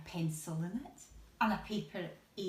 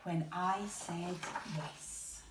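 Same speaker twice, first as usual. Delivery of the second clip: put-on reading to children; 4 dB louder, slower than usual.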